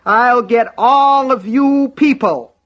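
slow, drawn-out high scream; raving, full-volume fury; screamed threat. A narrator's reading. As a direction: scream it wildly, stretching the words. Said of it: "Buster screamed back".

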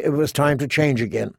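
plain reading voice

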